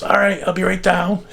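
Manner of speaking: high-pitched call from above; mock calling back. Father on the roof answers mother, mock call.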